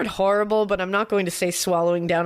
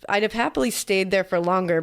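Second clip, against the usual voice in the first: ordinary delivery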